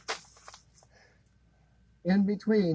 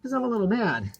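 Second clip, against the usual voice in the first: ordinary delivery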